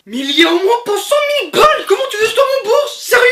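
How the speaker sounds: high shocked wail, near tears; shocked outcry. Acted. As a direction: cry out in disbelief at the bill. Son shocked by the debt bill.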